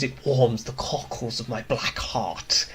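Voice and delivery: slow, soft villain impression; Robotnik. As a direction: savour it like a dark cartoon villain, voice held down. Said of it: "He'll say things".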